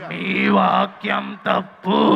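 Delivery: deep, slow, menacing creature voice; monkey voice. Acted. Monkey telling the children their verse is wrong, slow and deep. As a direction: drag each word out like a lurking beast.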